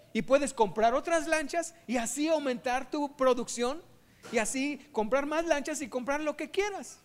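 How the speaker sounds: loud, rising hard sell; excited pitch. Acted. University man pushing the fisherman, loud and excited. Student urges fisherman to expand.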